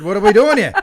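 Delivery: high, loud New York outburst. Acted. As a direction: protest like a hammed-up New York mother. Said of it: "that's how she sounded".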